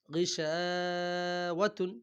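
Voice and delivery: slow chanted গিশাওয়াতুন; long, sung-out গিশাওয়াতুন; drawn-out recitation. Shown as a demonstration. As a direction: stretch গিশাওয়াতুন like tilawat. Quran reading drawn out.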